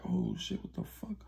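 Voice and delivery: shocked, hushed whisper; retold frightened whisper; scared whisper. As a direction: gasp it out, frightened. Scared girl after shooting.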